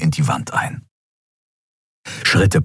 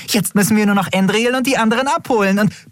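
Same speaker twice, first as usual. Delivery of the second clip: high, fast, eager chatter; excited babble; faster than usual. A narrator's reading. Nelen rushing on excitedly before she is cut off. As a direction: rush ahead with excitement.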